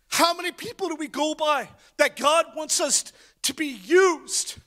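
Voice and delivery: fervent preacher at full volume; full-force preaching. Acted. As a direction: lift the congregation with every line.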